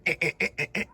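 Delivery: mock engine sputter, short repeated grunts; starter noise. Rider describing his failing starter, imitating the sputter. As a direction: imitate a bike that won't turn over. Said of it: "it was doing that".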